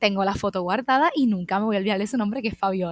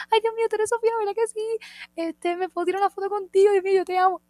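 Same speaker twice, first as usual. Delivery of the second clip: put-on higher, squealing fan girl; gushing fan; 11 semitones higher, 4 dB louder, faster than usual. Fan girl meeting Sofía, gushing high and excited. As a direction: gush like a starstruck fan.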